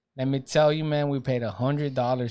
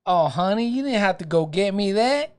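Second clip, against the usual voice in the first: rising higher sweet southern lady; 7 semitones higher than usual. Retold or imitated. Imitating the cashier lady, climbing higher and sweeter. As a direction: coo it like a kind cashier. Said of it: "this lady's like".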